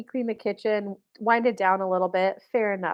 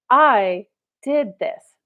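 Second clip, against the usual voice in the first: slow, chest-puffed boast; mock-heroic, each word stretched out; proud; 5 dB louder, much slower than usual. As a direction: imitate a man savoring his triumph. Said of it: "puffed his chest and felt like a man".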